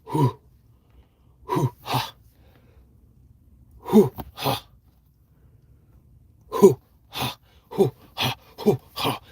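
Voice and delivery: a creature's rising war-chant of grunts; imitated creature huffing. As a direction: pump yourself up with breathy animal huffs. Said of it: "It starts going".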